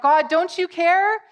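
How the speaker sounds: high, loud, drawn-out plea; crying out. Acted. Believers in hardship to God, crying out. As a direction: cry out slowly to God in protest. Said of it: "often we cry out, cry out".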